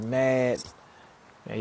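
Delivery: ordinary talk, nothing marked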